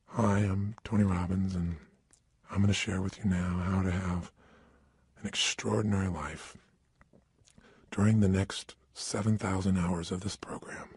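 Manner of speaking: bore them on purpose, flat and low; dull monotone. Shown as a demonstration. Speaker showing a lifeless low droning intro. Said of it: "speaking more like this".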